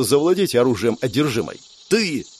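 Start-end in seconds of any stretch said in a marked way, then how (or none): 1.9-2.3 short, high-pitched bark. A narrator's reading. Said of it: "рявкнула Урсула".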